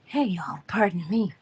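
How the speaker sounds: queasy, whispered apology; nauseous whisper. Real, not acted. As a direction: whisper it while feeling sick. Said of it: "I'm feeling rather nauseous this morning".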